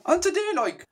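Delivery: high, exaggerated Scottish voice; Scottish accent. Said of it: "when I talk Scottish".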